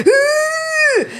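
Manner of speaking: very high straining squeal; imitated straining. Trying to lift the Tubie off him, imitated straining squeal. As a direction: mimic heaving at something too heavy. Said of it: "trying to pick up the Tubie".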